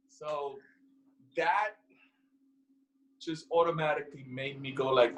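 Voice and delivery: usual speaking voice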